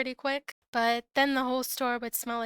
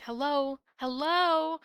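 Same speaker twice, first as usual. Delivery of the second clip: lightly raised mock yell; 3 semitones higher, slower than usual. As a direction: call it out, rising. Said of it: "pounding at the door yelling".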